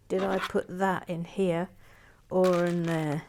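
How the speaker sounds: usual speaking voice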